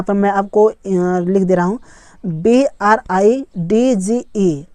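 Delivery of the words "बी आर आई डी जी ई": very slow, separated letters; one letter at a time; spelling it out. Shown as a demonstration. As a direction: spell it slowly for learners. Spelling a word aloud for learners.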